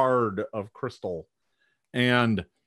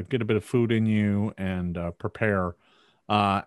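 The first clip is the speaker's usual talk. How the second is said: normal talking voice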